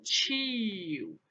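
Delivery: "tio" stretched long, gliding downward; slow, falling "tiiio"; drawn-out word. Shown as a demonstration. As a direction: draw out "tio" slowly as a key term. Teacher sounds out the term.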